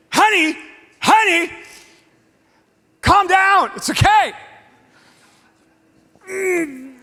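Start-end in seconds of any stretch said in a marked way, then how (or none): 0.1-4.3 a hint of wife calming him, raised; calm her husband down; soothing wife. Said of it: "Kim's like".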